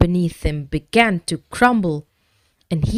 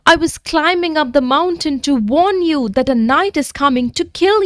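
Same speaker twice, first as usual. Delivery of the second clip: pleading; 8 semitones higher than usual. Acted. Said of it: "pleaded Jake".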